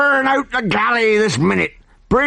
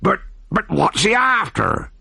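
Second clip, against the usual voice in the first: puzzled; 10 semitones higher than usual. A narrator's reading. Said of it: "inquired the puzzled pirate captain".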